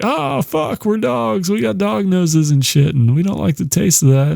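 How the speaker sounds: high, squeaky panicked dog voice; panicking dogs. Retold or imitated. Sprayed guard dogs freak out, high and squeaky. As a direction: yelp it like a dog hit with ammonia, falling back toward normal by the end. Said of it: "freaking out 'cause they're like".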